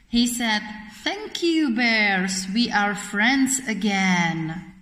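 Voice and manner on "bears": ordinary delivery, nothing marked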